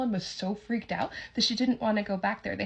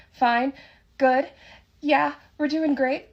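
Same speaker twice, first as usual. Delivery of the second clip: vague answers; 5 semitones higher, 8 dB louder, slower than usual. Retold or imitated. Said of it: "they both answered really vaguely".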